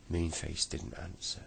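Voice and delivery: usual speaking voice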